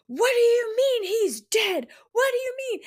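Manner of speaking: high-pitched, wailing mock outrage; outraged disbelief. Woman storming into the Assassin's Keep, high outraged disbelief. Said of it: "She storms into the Assassin's Keep, and she's like".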